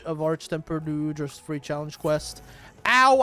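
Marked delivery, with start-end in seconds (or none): 2.8-3.2 sudden pained "ow"; sharp yelp of pain; stubbed toe yelp. Real, not acted. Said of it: "I just stubbed my toe".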